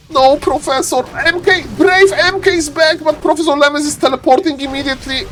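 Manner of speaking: high, urgent commentator cries; excited play-by-play. Real, not acted. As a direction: call the attack at a high, excited pitch.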